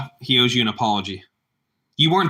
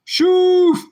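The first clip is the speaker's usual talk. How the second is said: high, loud whooshing noise; loud playful swoosh; whoosh sound effect; 7 dB louder than usual. Pretend. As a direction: blast a sudden mock whoosh. Sound effect after song.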